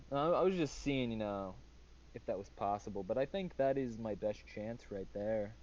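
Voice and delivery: ordinary talk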